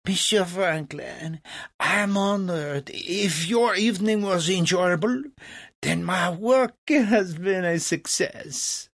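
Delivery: high, puffed-up French voice; proud French impresario. Acted. Legras thanks Franklin for his praise, grand and proud. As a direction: swell with honor at the praise.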